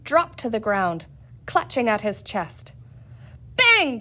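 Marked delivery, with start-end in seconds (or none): 3.6-4.0 high, loud pretend gunshot; bang cried. A narrator's reading. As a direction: shout bang like a cap gun. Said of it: "Peter shouted again".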